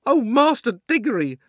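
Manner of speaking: high, eager maid calling; excited housemaid. A narrator's reading. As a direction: call out like a thrilled maid. Housemaid calls boy.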